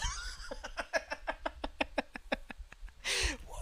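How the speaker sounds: real high, breathless laughing fit. Real, not acted. Host realizing he is talking shit, real wheezing laugh. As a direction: crack up helplessly at your own rant. Cracks up at own rant.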